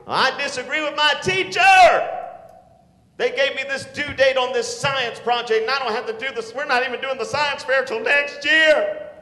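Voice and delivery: put-on loud, high griping teenager; ranting student. Student griping about a due date, loud and high. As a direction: rant like a fed-up student.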